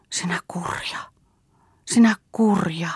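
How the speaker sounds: whispered cry from the soul; inner cry. A narrator's reading. Mirdia's soul cries silently at Bengt, whispered. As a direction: hiss the reproach inwardly. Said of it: "huusi Mirdian sielussa".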